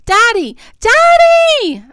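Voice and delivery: put-on high child's yell, rising to a shriek. A narrator's reading. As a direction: scream out for a parent across the pier. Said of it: "I yell".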